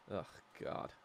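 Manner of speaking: breathless whispered ugh; exasperated whisper. Real, not acted. Thinking about sister's blunder, whispered exasperation. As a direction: sigh it out in disgust.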